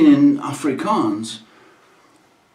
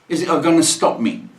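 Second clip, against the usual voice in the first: ordinary delivery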